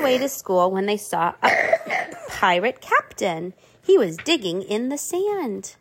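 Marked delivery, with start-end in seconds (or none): none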